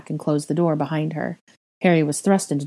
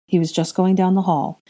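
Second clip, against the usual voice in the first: ordinary delivery, nothing marked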